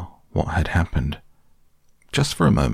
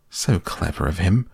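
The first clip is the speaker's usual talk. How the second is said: ordinary delivery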